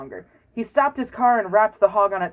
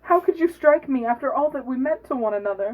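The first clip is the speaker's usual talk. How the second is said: hog voice only slightly higher; a touch higher than normal; raised voice; 5 semitones higher than usual. Acted. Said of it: "have the hog speak in a high voice".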